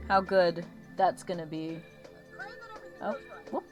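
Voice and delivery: ordinary talk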